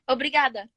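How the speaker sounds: "obrigada" with a tapped r and final -da; Portuguese correction. Shown as a demonstration. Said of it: "Do you know anything in Portuguese?".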